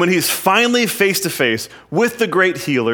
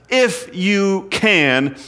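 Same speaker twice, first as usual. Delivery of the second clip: "If you can" drawn out slowly and raised; slow indignant quote; 6 semitones higher, much slower than usual. Acted. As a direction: linger on each word of "If you can", mildly indignant. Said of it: "he gets pretty indignant".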